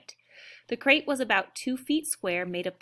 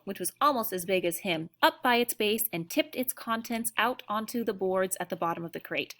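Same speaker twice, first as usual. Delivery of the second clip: plain reading voice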